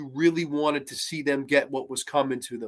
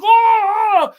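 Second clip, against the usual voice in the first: long, very high, loud agonized yell; hammed-up howl of pain; mock scream; 10 dB louder than usual. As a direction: howl like he's just been skewered. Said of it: "He's like".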